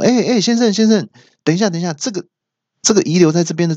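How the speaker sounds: high clerk calling after the customer. Retold or imitated.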